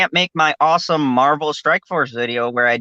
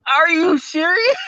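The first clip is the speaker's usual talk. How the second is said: incredulous outburst. Retold or imitated. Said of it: "And I'm like".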